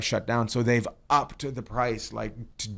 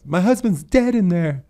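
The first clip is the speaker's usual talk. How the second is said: higher, louder imitation of the neighbor; a hammed-up distraught neighbor, higher and louder; retold widow; 10 semitones higher, 8 dB louder than usual. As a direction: replay her line, raised and pushed. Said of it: "And she goes".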